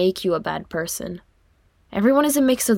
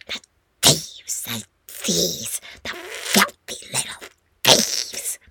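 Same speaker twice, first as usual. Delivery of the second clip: a slinking, spiteful creature muttering to itself; Gollum-like creature whisper; 3 semitones lower, much slower than usual. A narrator's reading. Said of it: "It whispered".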